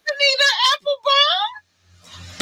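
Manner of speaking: high, disbelieving question; can't believe what she heard; shocked. Real, not acted. Shocked question about a song.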